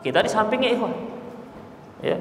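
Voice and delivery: ordinary delivery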